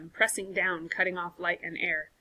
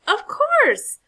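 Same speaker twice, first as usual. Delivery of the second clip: much higher, loud cry of discovery; excited realization; 11 dB louder than usual. Acted. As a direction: burst out with it. Sudden realization of the path.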